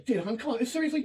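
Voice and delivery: exasperated. Real, not acted. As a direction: argue back, pitch jumping up. Couple arguing over being interrupted. (inis na pangangatwiran)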